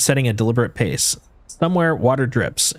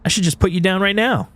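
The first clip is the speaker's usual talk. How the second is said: high, angry outburst; furious; 7 semitones higher than usual. Acted. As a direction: snap back in rage. Ankita furious at Ashton.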